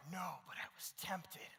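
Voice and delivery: gulping, slow whisper of a guilty friend; whispered confession. Retold or imitated. Friend whispers his confession to the pastor on the phone, slowly. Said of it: "And he's like".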